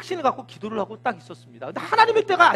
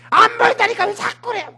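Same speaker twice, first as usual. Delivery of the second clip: higher, louder hammed-up complaint; temper flaring; 9 semitones higher, 5 dB louder than usual. Servant fed up with being sent up again, angry complaint. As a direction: whine it back exasperated. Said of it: "성질을 내면서".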